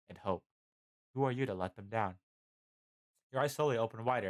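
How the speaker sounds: ordinary delivery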